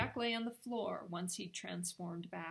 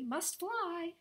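higher, light and polite; a quick polite parting line in a higher voice; polite Jack; 9 semitones higher, 3 dB louder than usual. Acted. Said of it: "said Jack politely".

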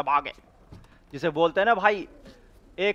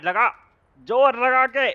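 ordinary delivery

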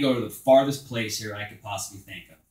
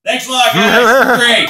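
sarcastic thanks; 11 semitones higher, 14 dB louder, slower than usual. Real, not acted.

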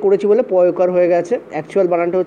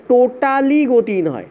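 totally drawn out slowly and raised; teacher emphasising the key word of the answer; stress on totally; 4 semitones higher, much slower than usual. Shown as a demonstration. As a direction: lean hard on totally. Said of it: "emphasising the key answer".

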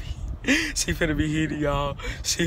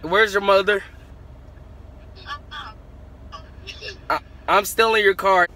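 higher, louder playful taunt; 6 semitones higher, 7 dB louder than usual. Real, not acted. Vlogger taunting his cousin about stealing the car, loud. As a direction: call it out to rile him up. Teasing cousin over phone.

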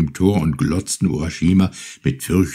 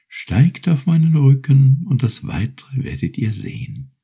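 high, gentle turtle voice, unhurried; grateful little turtle; 7 semitones higher than usual. Acted. Turtle thanks Urashima for freeing her, high and gentle. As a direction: offer your thanks like a small polite sea creature.

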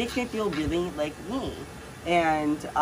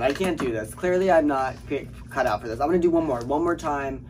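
ordinary delivery

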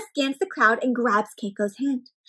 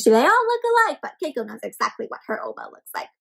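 ordinary delivery, nothing marked

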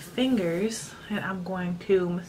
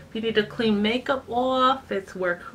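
muffled behind hand. Real, not acted. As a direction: hand over mouth. Seen 0: Cotton pad at nose, hand covering mouth until about 0.8s.

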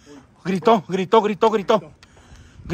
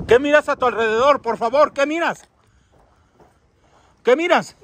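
calling out; 6 semitones higher, 3 dB louder than usual. Real, not acted. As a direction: project the question into the distance. Calling questions to the spirit. (preguntando a voces)

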